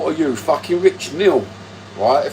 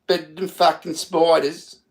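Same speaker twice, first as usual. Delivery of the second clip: put-on Australian accent; 4 semitones higher than usual. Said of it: "That's what they say in Australia".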